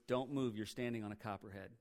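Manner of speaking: low, soft imitation of a cautious friend; retold hushed warning. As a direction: quote a careful warning, keeping it down. Friend warns of snake underfoot.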